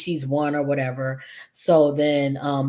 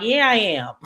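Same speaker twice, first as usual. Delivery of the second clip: mock-defiant, pitched up; defiant comeback; 6 semitones higher than usual. Imagining herself as Tomas answering Bill, a playfully defiant comeback. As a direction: throw it back boldly, half in jest.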